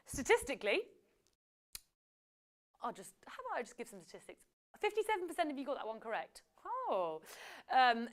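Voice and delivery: normal talking voice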